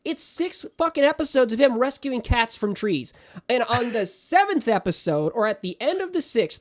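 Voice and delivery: loud, high exasperation; impassioned rant. Real, not acted. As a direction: complain with real heat. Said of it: "I'm very impassioned".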